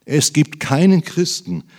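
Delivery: ordinary delivery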